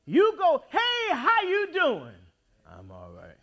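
ordinary talk, nothing marked

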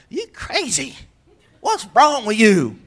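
mock scolding. Preacher mocking a parent scolding their crazy child, sharp scorn. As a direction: snap at them in mock disgust.

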